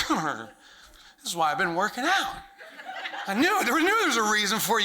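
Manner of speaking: proud and amused, laughing as you talk; laughing boast. Retold or imitated. Bragging to yourself while squeezing the tube, laughing. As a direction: chuckle through a cocky brag.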